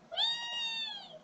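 very high playful squeal; squeaky whee. Pretend. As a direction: squeal with glee. Playful cry.

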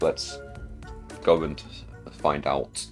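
normal talking voice

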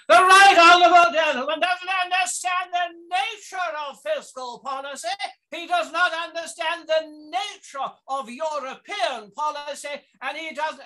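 high, loud Commons Thatcher voice; Thatcher impression. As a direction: lecture the honourable gentleman as Thatcher. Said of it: "the voice of Thatcher that she did in the House of Commons".

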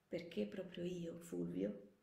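subdued teacher's gentle correction; gentle questioning. Acted. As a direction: hand his question back quietly. Teacher gently turns his question back.

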